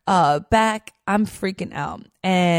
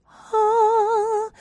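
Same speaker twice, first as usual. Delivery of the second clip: sigh of relief. Retold or imitated. Relief at finding a dock.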